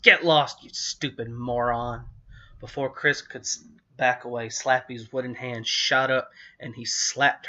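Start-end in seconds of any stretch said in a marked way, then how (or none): 0.0-2.1 slow, louder snarl of an evil dummy; harsh raspy snarl. A narrator's reading. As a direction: growl it like a nasty puppet. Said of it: "he snarled in a harsh, raspy voice".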